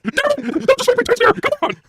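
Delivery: high, wailing plea; mock Heyman despair. Heyman begs Brock for help, high wail. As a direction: cry out to Brock in a squeaky wail. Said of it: "K J V says impression".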